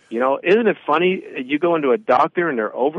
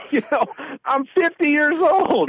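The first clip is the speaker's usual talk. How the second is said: incredulous scoff; 10 semitones higher than usual. Retold or imitated. Mocking the doctor in retelling.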